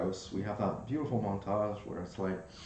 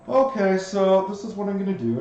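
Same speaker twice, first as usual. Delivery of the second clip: higher, louder brisk medic; 8 semitones higher, 9 dB louder than usual. Acted. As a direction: bustle in as an efficient fey nurse. GM voicing the fey medic.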